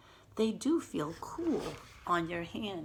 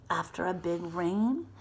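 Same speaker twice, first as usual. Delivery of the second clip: plain reading voice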